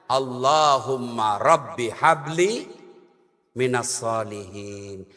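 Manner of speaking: low, slow, reverent chant of the prayer; prayer recitation. Acted. Preacher recites Ibrahim's prayer for a pious son, low and slow. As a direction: intone it like a prayer to God.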